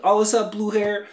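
high, loud call-out to a classmate; loud retold greeting. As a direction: holler it like a breezy hallway hello. Retelling carefree hallway greeting to classmate.

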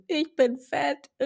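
high, slow whiny wail; whiny self-pity. Retold or imitated. Quotes her own past self. (hohes, langsames Jammern)

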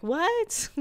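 high startled what; the cop can't believe it; baffled what. Retold or imitated. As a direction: cop reacting to Frosty, high disbelief. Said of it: "he's like".

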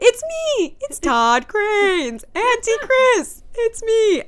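high, loud, drawn-out sing-out; serial killer energy. Acted. Mocking a celebrity's video plea, hammed-up creepy high call. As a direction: stretch each name into a creepy high call. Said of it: "That's what it looked like".

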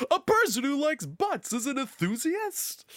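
a high, slow, sing-song sitcom comedian delivery; Jerry Seinfeld. Shown as a demonstration. Reading a listener's statement aloud as a comedian, high and slow. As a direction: lift it into a slow, wry stand-up question. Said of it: "read that like Jerry Seinfeld".

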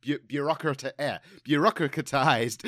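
ordinary delivery

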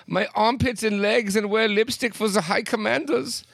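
high-pitched mock German accent. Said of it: "saying this in a German accent".

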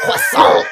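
croissant with a hacked, throaty French r. Pretend. Friend mocks the French r sound, throaty and exaggerated. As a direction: spit out the r in croissant. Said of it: "Did you just hack a loogie?".

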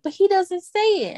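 high-pitched mock complaint; imitating complaining mom. Mom complaining to daughter about her boyfriend, mock plaintive. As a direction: voice a mom's plaintive objection. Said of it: "And she be like".

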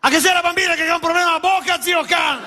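high, shouted mock old Venetian mother; worried grandma. Grandmother phoning son about the child's speech, shouted. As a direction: ham up the alarmed old mother. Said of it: "mi dice".

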